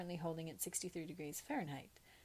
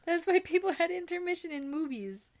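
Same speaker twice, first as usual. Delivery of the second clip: excited; 8 semitones higher, 11 dB louder than usual. Real, not acted. Laughing rant about bathroom breaks.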